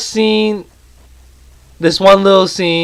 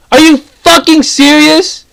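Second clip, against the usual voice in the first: much higher, slow, incredulous yell; exasperated outburst; 10 semitones higher, slower than usual. Real, not acted. Reviewer snapping at movie nitpickers, incredulous high outburst. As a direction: throw it at them in disbelief. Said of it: "I'm about to get really pissed off".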